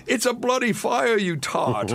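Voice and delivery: high-pitched, hammed-up British accent; indignant Brit, badly imitated. Hotel guest banging on the bus, hammed-up British accent. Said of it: "I can't do a British accent".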